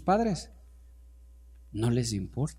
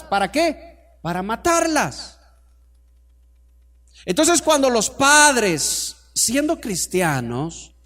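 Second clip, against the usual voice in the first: loud, raised pulpit voice, stretching key phrases; full-force preaching; 7 semitones higher, 7 dB louder than usual. Real, not acted. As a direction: proclaim it forcefully to the congregation. (prédica a viva voz, con frases alargadas)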